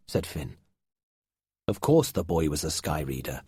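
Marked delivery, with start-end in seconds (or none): none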